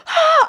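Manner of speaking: high, loud gasping shriek; excited scream. Retold or imitated. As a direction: re-enact a short excited scream. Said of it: "I, like, screamed".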